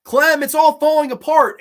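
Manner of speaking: high, loud cry of alarm, imitated; retold yell. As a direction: imitate a man yelling out in alarm. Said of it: "he's yelling at her".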